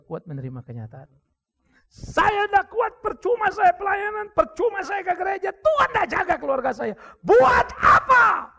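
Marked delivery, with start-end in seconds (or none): 1.9-8.6 high, loud and slow, dragging each cry out; despairing shout. Retold or imitated. Pastor acting the wife's despair at God, slow high wail. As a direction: wail it like faith has collapsed.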